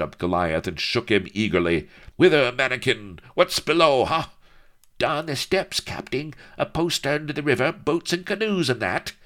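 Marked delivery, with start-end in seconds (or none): 2.2-4.3 eager rogue. A narrator's reading. Bilbo questions Goliath eagerly. 5.0-9.1 dwarf voice. A narrator's reading. Goliath answers the captain.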